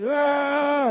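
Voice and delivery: drawn-out mock battle yell; imitated war cry. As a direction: hold a long "Ah!" like an army's chant. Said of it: "chanting whatever chants they had".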